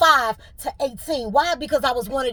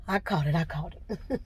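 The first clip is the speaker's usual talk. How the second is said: regaining composure; 5 semitones lower, 8 dB quieter than usual. Real, not acted. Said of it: "trying not to cry".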